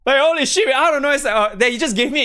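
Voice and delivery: high and loud, overwhelmed; can't believe the friend's generosity; excited. Real, not acted. Real excitement about friend's gift.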